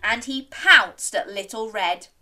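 ordinary delivery

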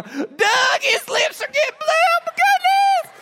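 very high, loud mock shriek; hammed-up hysterical mother screaming to her husband; freaking-out mom. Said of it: "freaking my mom out even more".

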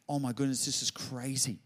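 usual speaking voice

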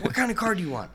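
dad's lifted, eager voice; dad's eager question. Retold or imitated. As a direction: pitch it up like a keen dad. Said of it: "my dad's like".

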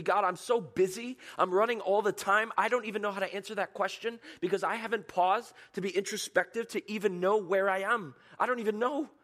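high, loud, rushed overwhelm; overworked believer, no time to think; frantic. Pretend. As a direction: rattle it off breathlessly. Frantic busy person voiced.

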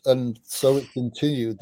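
ordinary delivery

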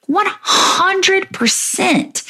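slow, high, emphatic "One hundred percent"; emphatic agreement. Real, not acted.

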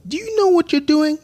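put-on high-pitched kid's British accent; cheeky British kid ribbing a grown-up, pitched high. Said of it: "I did it in a British accent".

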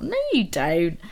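ordinary talk

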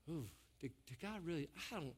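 very soft, hesitant muttering; second-guess yourself under your breath; inner doubting voice. Acted. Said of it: "there's something that says".

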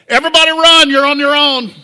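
loud, high mock panic yell; preacher playing a panicked leader yelling to flee; panicked shout.

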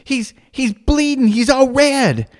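high, drawn-out mock child alarm; high-pitched kid reporting an emergency; Alex. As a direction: imitate a panicked little girl, slow and high. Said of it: "She goes".

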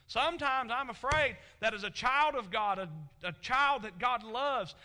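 loud, ringing pulpit delivery; preaching at full force. Acted. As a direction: drive each line home to the congregation. Preacher builds to loud emphasis.

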